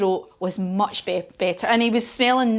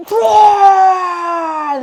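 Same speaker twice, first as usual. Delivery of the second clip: big, slow, shouted dragon roar; a long, loud demo roar for the children; 8 semitones higher, 8 dB louder than usual. Shown as a demonstration. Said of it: "let's do a couple more roars".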